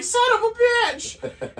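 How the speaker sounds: very high, slow pained yelp; mock yelp. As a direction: yelp like it just hurt. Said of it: "I was like".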